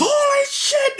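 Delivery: very high mock shriek; crowd's alarm. Everyone flees the plague boy, high mock shriek. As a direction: squeal it in hammed-up panic. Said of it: "And everyone goes".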